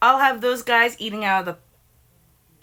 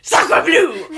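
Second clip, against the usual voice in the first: hammed-up French accent exclamation; 6 semitones higher, 6 dB louder than usual. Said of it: "you should have a French accent".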